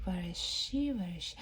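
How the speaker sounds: hushed searching whisper; soft curious whisper. Acted. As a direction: whisper as if peeking for her.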